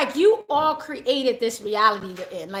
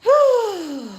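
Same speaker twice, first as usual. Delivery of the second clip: relieved whew; 9 semitones higher, 7 dB louder than usual. Real, not acted. Relief after affirmation.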